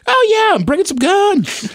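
high-pitched, hammed-up breezy boast; mock nonchalance. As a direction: imitate someone absurdly carefree at the border. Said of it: "being that casual going into Canada".

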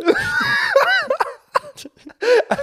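long loud laugh, high and crying; laughing till it sounds like crying. Real, not acted. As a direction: howl with laughter. Laughs at own story.

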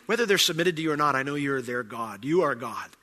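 normal talking voice

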